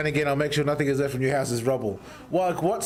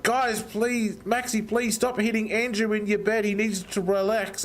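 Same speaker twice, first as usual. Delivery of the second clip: higher-pitched mock pleading; pleading imitation; 8 semitones higher than usual. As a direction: plead like a worried friend. Mimics friends pleading with him.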